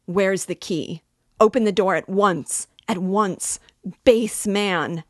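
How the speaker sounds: indignant, urgent shout; angry demand. A narrator's reading. Trapped woman demands the key.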